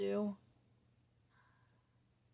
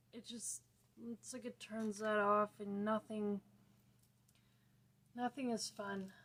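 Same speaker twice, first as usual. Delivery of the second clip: ordinary talk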